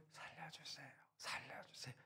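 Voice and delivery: barely whispered plea for help, no voice at all; timid whisper. Shown as a demonstration. Preacher mocking a half-hearted prayer, whispered. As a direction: whisper the cry for help as if embarrassed. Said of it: "그렇게 기도하는".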